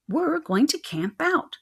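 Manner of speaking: high, slow squeak; squeaky. Acted. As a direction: squeak it out brightly. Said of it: "she squeaked".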